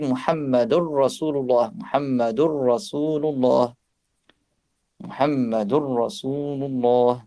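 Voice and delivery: slowing "muhammadur rasulullah", no n before the doubled r; idgham with ra. Shown as a demonstration. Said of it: "avec la règle de tajwid". ("muhammadur rasulullah" lentement, noun fusionné dans le ra)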